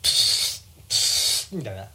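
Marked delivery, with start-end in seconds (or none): 0.0-0.9 unvoiced mouth rip noise; a quick breathy tearing pop; paper tearing sound. Shown as a demonstration. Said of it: "髪を破る音ね".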